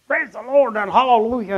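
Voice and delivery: different preacher voice. Retold or imitated. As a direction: proclaim it like a fervent believer. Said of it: "in a different even voice".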